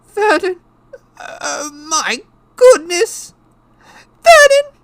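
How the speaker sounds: very high, loud horrified wife; choking shock. A narrator's reading. Wife horrified by the letter, high choking cries. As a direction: shriek it, half choking. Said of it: "made a choking noise".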